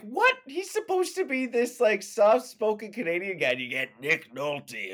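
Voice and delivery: high, disbelieving squeal; incredulous girlfriend. Retold or imitated. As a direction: play the girlfriend baffled by the casting. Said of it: "she's just like".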